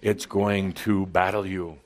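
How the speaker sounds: normal talking voice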